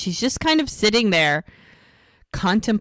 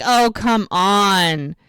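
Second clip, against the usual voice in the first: drawn-out, louder groan of protest; mock exasperation; 4 dB louder, much slower than usual. Man protesting as the cell phone chimes, exasperated. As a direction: play it put out, dragging the words.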